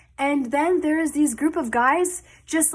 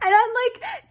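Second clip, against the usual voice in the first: high-pitched, laughing lead-in; 11 semitones higher than usual. Real, not acted. Storyteller cracking up at grandpa's bar invitation, laughing. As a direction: laugh through the words.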